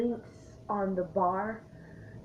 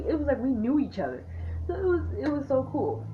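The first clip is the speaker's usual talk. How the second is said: ordinary delivery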